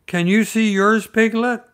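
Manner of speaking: put-on high and loud, eager child asking; excited Roo. A narrator's reading. Roo asking Piglet at the bridge, eager and high. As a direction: bounce up on the question. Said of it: "said Roo".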